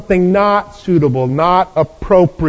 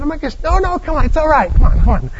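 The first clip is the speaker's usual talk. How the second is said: coaxing host; 7 semitones higher, faster than usual. Acted. Host coaxing leaving friend back.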